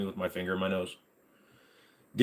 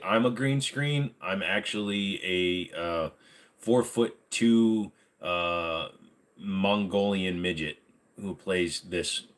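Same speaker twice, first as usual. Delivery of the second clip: slow deadpan; slower than usual. Pretend.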